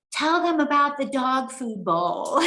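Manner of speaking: high, slow, drawn-out plea; imitating emotional dad. Daughter imitates her dad asking for the dog bowl story, emotional and drawn out. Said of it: "he gets really emotional".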